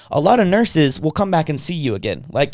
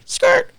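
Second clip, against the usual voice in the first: very high screeched "skrrt"; mock tire screech. Staff suddenly reversing their refusal, mock screech. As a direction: imitate brakes squealing to a halt.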